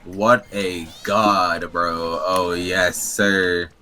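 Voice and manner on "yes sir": ordinary talk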